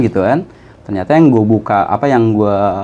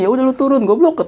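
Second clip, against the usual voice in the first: mock scolding through laughter; 13 semitones higher than usual. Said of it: "Gue dimarahin sama abang gue".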